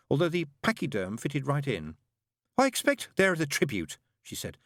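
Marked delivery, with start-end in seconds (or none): 2.6-4.0 high, bright-voiced chattering nun; fussy nun. A narrator's reading. Nun greeting the father in a high voice. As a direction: pitch it high like a flustered nun. Narrator voices Sister Mary.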